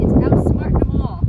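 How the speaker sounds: hoarse excited call; raspy voice. Acted. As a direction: rasp it out loud. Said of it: "his voice rasped".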